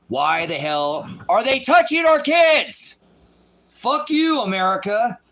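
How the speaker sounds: high, loud and slowed, yelling at the country; raging yell at America; furious shout. Real, not acted. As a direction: bellow it out in outrage. Angry rant at airport searches.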